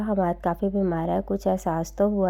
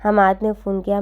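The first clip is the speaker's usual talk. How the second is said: usual speaking voice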